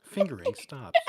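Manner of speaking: loud, flat airport announcer voice; airport announcement. Pretend. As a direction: announce it over the terminal speakers. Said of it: "an airport announcer voice".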